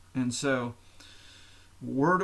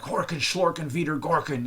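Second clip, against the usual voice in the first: mock German; 4 semitones higher, 3 dB louder than usual. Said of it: "it sounds like they're really mad at you".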